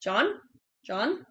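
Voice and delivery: soft JOHN with a questioning rise; questioning intonation. Shown as a demonstration. Coach mimicking people unsure of their own name, questioning intonation. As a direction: say JOHN quietly, rising as if unsure of it. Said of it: "It's a question".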